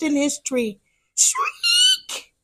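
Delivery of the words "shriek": high breathless whispered owl screech; owl shriek. Acted. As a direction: hiss a thin high shriek in greeting. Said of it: "he greeted his visitors".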